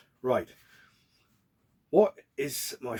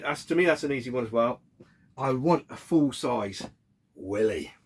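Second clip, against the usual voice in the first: ordinary talk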